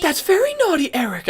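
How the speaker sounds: high, sing-song cartoon mother; Eric's mom. Cartoon mother chiding her son, high-pitched impression. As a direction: scold sweetly in a high voice. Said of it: "I can probably do his mom".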